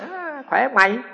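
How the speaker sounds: mock hearty greeting. Neighbour greets the monk with a mock hearty hello.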